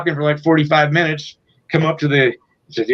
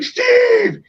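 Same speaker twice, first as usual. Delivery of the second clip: excited call. Retold or imitated. Singer calling to his bandmate, very high excited cry. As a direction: yelp his name like a rescue.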